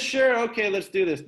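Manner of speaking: high, loud, eager yes from a student. Pretend. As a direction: play the keen student jumping in. Teacher voices student Aaron agreeing.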